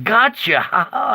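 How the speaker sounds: spring the trap with a cackle; God gleefully catching Satan out; triumphant gotcha. Retold or imitated.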